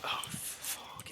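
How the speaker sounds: under-the-breath whisper of oh, fuck; hushed aside curse. Real, not acted. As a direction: breathe it out unvoiced. Aside while telling story.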